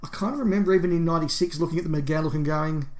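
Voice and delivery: normal talking voice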